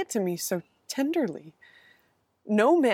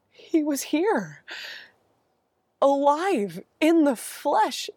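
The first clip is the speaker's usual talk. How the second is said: overwhelmed joy; 5 semitones higher, 4 dB louder, much slower than usual. Acted. Overwhelmed joy at seeing him.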